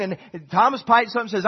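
normal talking voice

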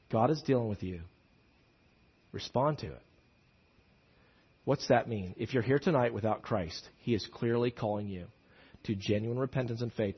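hushed evangelistic appeal; soft altar call. Acted. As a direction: draw them in gently to respond. Quiet altar-call invitation.

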